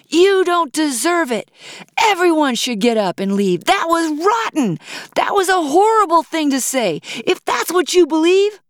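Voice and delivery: loud, high-pitched angry heckler; heckling. A narrator's reading. Angry woman in the audience at the singer, loud heckling. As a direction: shout it indignantly at the stage. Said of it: "The same woman continued heckling her".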